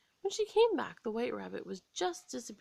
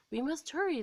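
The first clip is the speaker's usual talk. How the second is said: higher, louder urgent call; urgent gardeners; 7 semitones higher, 5 dB louder, faster than usual. A narrator's reading. Gardeners hurrying before the Queen arrives, urgent and louder. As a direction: hurry the others along. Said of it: "they said".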